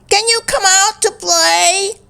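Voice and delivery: high, eager friend voice; high playful call. A narrator's reading. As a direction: call her out to play. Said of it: "cried Cody".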